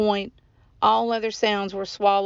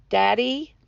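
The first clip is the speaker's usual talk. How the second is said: a higher, rising question; mildly anxious call, hoping for an answer; tentative searching call; 4 semitones higher than usual. A narrator's reading. Boy calls for missing father.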